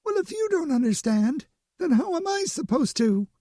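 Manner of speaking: high, shaky panicked retort; trembling. A narrator's reading. Frightened invisible woman to her mate, trembling high voice. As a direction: snap back in fear. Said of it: "Her disembodied voice trembled".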